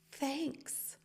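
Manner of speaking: soft, high-pitched thanks; high coy thanks. Retold or imitated. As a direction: flirt shyly in a little high voice.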